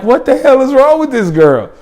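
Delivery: high-pitched incredulous question; bewildered. Retold or imitated. Reacting to the girl bawling real tears, bewildered.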